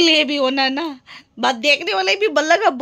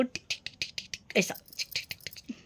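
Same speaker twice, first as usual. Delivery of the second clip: whispered tick tick of a clock; unvoiced clock ticks; 16 dB quieter, faster than usual. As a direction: tick softly like a clock counting down. Imitating a ticking clock.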